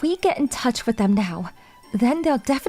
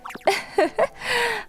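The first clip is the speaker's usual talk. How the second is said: high, amused giggle; light giggle; 9 semitones higher than usual. Acted. Idol amused by serious roommate, giggling. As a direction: giggle fondly.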